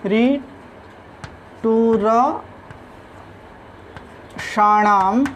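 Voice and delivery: very slow, drawn-out रिटुरषाणाम, pausing between syllables. Shown as a demonstration. Teacher dictating a Sanskrit rule to students, very slowly in separated syllables.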